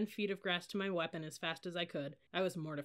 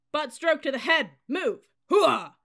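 high, loud military order and cry; shouted drill command; 8 semitones higher, 12 dB louder, slower than usual. A narrator's reading. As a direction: shout it to the whole formation. Bayonet drill command and response.

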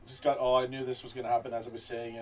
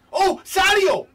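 high, loud cry of the name; excited shout; 13 dB louder, slower than usual. Real, not acted. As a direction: shout as the foul happens. Penalty won.

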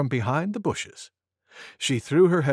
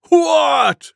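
high, loud buffalo bellow; 13 semitones higher, 9 dB louder than usual. A narrator's reading. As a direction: bellow it out in astonishment. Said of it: "bellowed Wild Buffalo".

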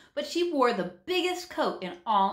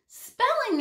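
higher, louder shocked cry of Spelling!; exclaimed; 5 dB louder than usual. A narrator's reading. Jenny reacting to the hobo's answer, exclaimed. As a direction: blurt Spelling! in dismay. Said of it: "exclaimed Jenny".

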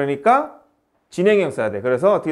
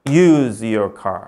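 slow dictation; 4 semitones lower, much slower than usual. Shown as a demonstration. Teacher dictating example sentence.

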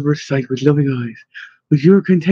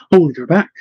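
ordinary delivery

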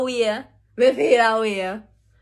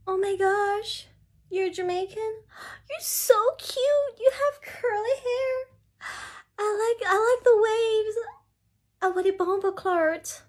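much higher, cooing, cutesy mock admirer; mock gushing foreigner; 8 semitones higher, 4 dB quieter than usual. Mocking a foreigner who gushes over a Jamaican, high cutesy voice. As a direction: gush like a tourist fawning over her. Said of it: "they'll be like".

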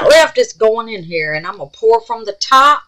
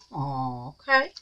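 normal talking voice

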